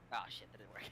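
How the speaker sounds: normal talking voice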